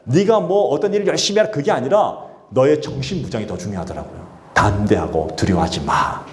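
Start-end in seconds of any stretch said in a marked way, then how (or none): 4.5-6.2 lower, slow, "담대하고" leaned on; deliberate, tender command; slow low reassurance; slow fatherly assurance. Acted. God's word repeated.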